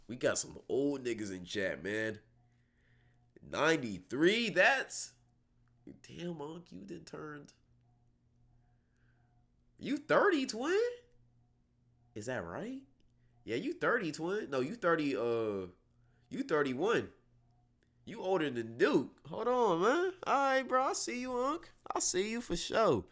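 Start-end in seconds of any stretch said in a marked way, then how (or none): 3.5-4.5 slow, higher questioning rise; incredulous. Real, not acted. Stunned by a viewer's birth year. 4.5-23.0 high, squeaky-pitched astonishment; teasing disbelief. Real, not acted. Teasing older viewers in disbelief.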